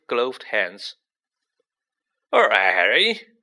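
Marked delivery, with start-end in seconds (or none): 2.3-3.3 big, loud greeting with a swooping rise; Hagrid. A narrator's reading. Hagrid hailing Harry in the corridor, loud and hearty. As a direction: boom it out, pitch dipping then climbing.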